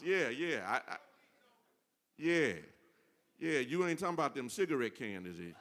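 usual speaking voice

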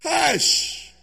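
high scolding hush; sharp hush. Acted. Crowd hushing the beggar, scolding.

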